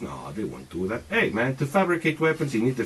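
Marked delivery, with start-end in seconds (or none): 0.0-1.0 mock doubter. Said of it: "if you think this is".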